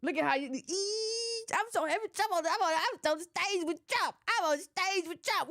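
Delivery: mock the clown bragging. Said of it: "this is clown work".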